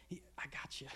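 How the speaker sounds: hushed, creeping whisper; sinister whisper. Acted. Nagging thought taunts the listener, sinister whisper.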